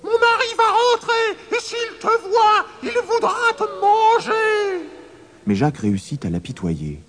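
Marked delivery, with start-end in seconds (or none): 0.0-4.9 very high, squeaky giantess. A narrator's reading. Giantess chasing Jacques away, shrill. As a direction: shoo him away in a tiny shrill voice. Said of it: "répondit la géante".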